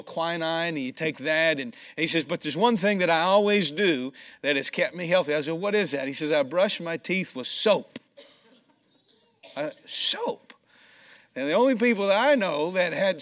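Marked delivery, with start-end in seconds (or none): none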